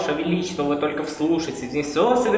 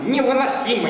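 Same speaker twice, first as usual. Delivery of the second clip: high, loud parroting echo; mocking echo; 8 semitones higher, 5 dB louder than usual. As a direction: parrot her word back, higher. Troll echoes queen.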